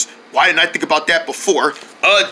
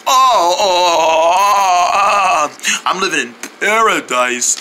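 blissful moaning; much slower than usual. Acted. Back massage bliss.

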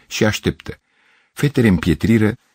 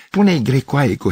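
raised-pitch call, no real shout; understated cry; 7 semitones higher than usual. A narrator's reading. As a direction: call it over to her, pitched up. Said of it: "strigă țiganca".

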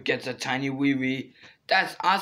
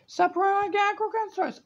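squeaky fast scolding; much faster than usual. Pretend.

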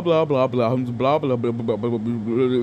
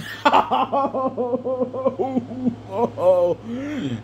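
high laugh; 9 semitones higher than usual. Real, not acted. Laughs at his own joke.

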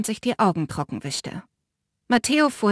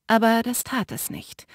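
plain reading voice

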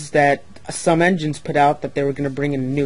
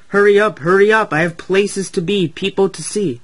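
louder, higher impatient hurrying; rude, impatient; 5 semitones higher, 6 dB louder than usual. A narrator's reading. Rude engine hurrying passengers at the station, impatient. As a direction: rush them along rudely. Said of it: "Emily was rude to the passengers too".

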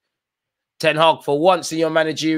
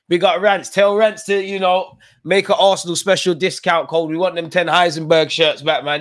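hyped shout-out; 6 semitones higher, 6 dB louder than usual. Real, not acted.

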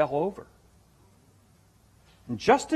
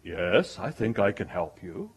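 1 God's deep voice; 6 semitones lower, 7 dB quieter than usual. Retold or imitated. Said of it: "this deep, booming voice from heaven".

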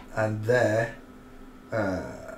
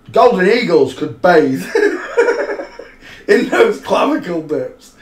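loud, high, breaking into laughter mid-line; laughing through it; 7 semitones higher, 10 dB louder than usual. Real, not acted. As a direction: crack up as you say it. Laughing at own joke.

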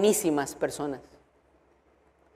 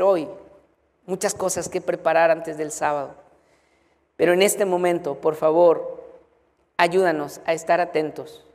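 prayer; 2 semitones lower than usual. Acted. Pastor prays for the congregation.

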